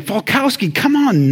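high-pitched imitation of a fed-up coach; mock an irritated tutor losing patience; exasperated coach. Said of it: "he was getting irritated and angry".

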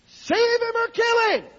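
very high, loud, drawn-out cry; desperate shouted prayer. Retold or imitated. Desperate wife begging God about her husband, slow high shout. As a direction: wail it to heaven in desperation. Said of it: "finally she got so desperate".

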